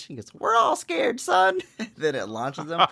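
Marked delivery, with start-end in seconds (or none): 0.3-1.6 very high, squeaky war-movie turtle; talking turtle sergeant. Retold or imitated. Turtle sergeant to a scared soldier before launch, high squeaky voice. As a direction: answer as a tiny cartoon sergeant. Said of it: "then you hear the turtles talk".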